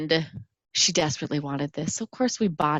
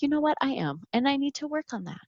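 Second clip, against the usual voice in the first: much higher, gentle reassuring voice; gracious nurse; 9 semitones higher, 3 dB quieter, faster than usual. Retold or imitated. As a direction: lightly voice the kind nurse smoothing it over. Nurse answers the boy.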